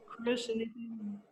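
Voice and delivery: soft, high, drawn-out prompt; Lauren. Retold or imitated. Boss calling on a writer in the pitch meeting, softly. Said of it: "And Lauren goes".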